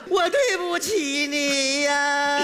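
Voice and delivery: very slow, drawn-out mock wail; fake crying. Young man posing as the sorry customer, wailing in pretend tears. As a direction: stretch the apology into a hammed-up lament. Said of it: "你，你还哭吗？".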